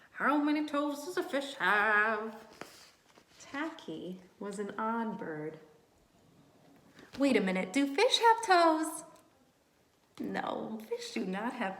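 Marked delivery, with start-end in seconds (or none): 0.1-2.3 goofy silly penguin song line. Acted. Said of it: "always sang songs like". 7.2-9.0 loud high wait a minute; hammed-up surprise for the kids; mock surprise.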